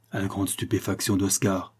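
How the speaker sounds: ordinary delivery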